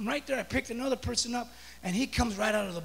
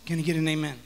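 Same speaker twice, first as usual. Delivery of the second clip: normal talking voice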